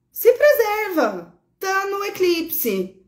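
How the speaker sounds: urgent plea. Real, not acted. Urging Pisces rising to self-protect.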